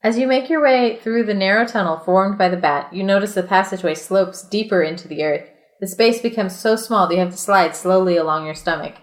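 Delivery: steady read-aloud storyteller voice; reading the adventure book aloud to friends, measured narration; story narration. A narrator's reading. Reads gamebook narration aloud.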